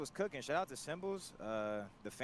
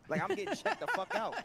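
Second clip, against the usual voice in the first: exasperated outburst; 3 dB louder than usual. Retold or imitated. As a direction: voice jumps way up in disbelief. Exasperated retelling of fan harassment.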